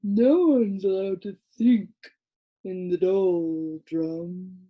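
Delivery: slow, sleepy drawl; a creature nodding off mid-sentence; dozing creature. A narrator's reading. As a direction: drift off while saying it. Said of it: "continued the third, beginning to doze off".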